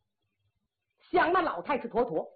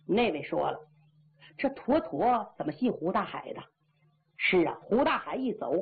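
usual speaking voice

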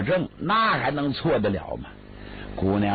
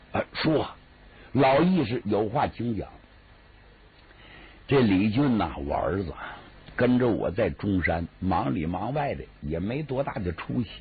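put-on lower, deferential old gentleman; bow low with your voice, humble petitioner; old father humbly asking the official a favour, low and deferential; humble old man; 3 semitones lower than usual. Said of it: "李广通就说".

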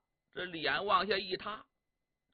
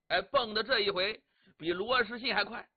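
usual speaking voice

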